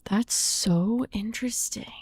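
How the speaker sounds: soft whisper; quiet whispered wonder. Real, not acted. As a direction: breathe it, hushed and fascinated. Hushed reaction.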